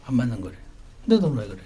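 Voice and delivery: ordinary talk, nothing marked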